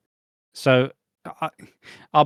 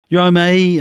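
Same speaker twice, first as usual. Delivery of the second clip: loud, higher, chummy call; loud cheery greeting; 7 semitones higher, 10 dB louder than usual. Retold or imitated. Neighbour calling from his car, loud and chummy. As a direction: hail him loudly from a slowing car. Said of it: "he's driving".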